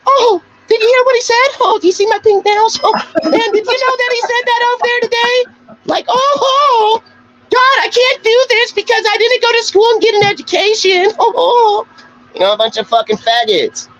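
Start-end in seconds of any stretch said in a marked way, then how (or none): 0.1-11.9 mocking gossip voice. Streamer mocking rivals who gossip in private, high mock falsetto. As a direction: ham it up like a fussy gossip showing off pink nails, ending in a fake whimper. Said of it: "I don't go over there and be like".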